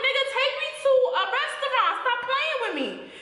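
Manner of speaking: very high, fast, half-laughing outburst; exasperated demand. Retold or imitated. She tells her date to find a restaurant, exasperated. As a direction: retell it as a squealed, impatient demand.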